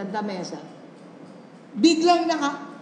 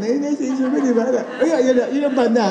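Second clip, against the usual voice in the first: ordinary delivery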